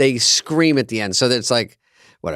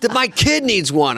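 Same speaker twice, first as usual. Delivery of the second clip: higher, slow, whiny plea; pushy fan; 8 semitones higher, slower than usual. Retold or imitated. Fan demanding an autograph for a kid, higher whiny mock plea. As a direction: mimic an entitled fan begging. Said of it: "And they go".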